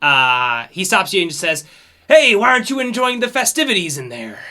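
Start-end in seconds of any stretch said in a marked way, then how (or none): 2.1-4.4 high, fast, hearty captain's hail; cheery pirate captain. Acted. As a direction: call out like a jovial captain catching someone sneaking off. Captain greets player on pier.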